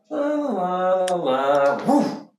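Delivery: sing-song la-las with a high woo; lilting, playful cat noises; carefree cat. Acted. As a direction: play a cat idly amusing itself. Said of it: "the cat's just sort of like".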